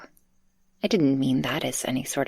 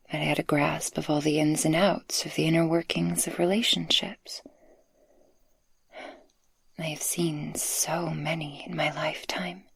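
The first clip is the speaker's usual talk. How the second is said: tender whisper. Acted. Speaker confessing love to the listener, tender whisper. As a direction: open your heart in a hush.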